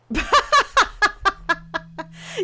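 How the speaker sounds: big high burst of laughter; loud laugh. Real, not acted. Laughing at a picture.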